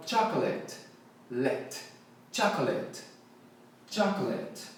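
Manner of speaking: "chocolate" as CHA-ko-let, short unstressed "let"; stress on first syllable. Shown as a demonstration. Teacher models "chocolate" for learners, first syllable stressed. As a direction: hit CHA in "chocolate", lighten the ending to "let". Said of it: "a sílaba tônica é o cha".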